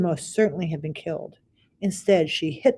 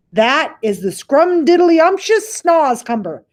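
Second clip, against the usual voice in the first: high, slow, loud sly praise; BFG's voice; 9 semitones higher, 7 dB louder than usual. Acted. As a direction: sell the vegetable with relish. BFG praising the vegetable.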